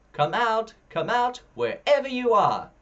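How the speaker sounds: loud high sing-song call. A narrator's reading. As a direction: call out searching the woods. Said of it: "the fairies call".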